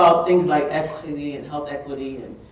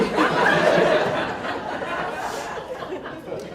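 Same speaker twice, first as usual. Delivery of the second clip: high unvoiced laughter; real airy laughter; breathless laugh; 9 semitones higher than usual. Real, not acted. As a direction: laugh helplessly. Laughing after the quote.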